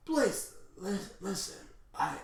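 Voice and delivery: soft, broken, gasping words; acted death-bed stammer; dying confession. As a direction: force out last words as breath runs out. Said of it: "Sonic passes away".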